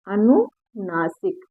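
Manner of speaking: अनुनासिक stretched slowly and said quietly; slow, soft drilled answer. Shown as a demonstration. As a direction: model अनुनासिक slowly for the class. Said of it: "क्या कहेंगे?". (धीरे-धीरे, धीमी आवाज़ में)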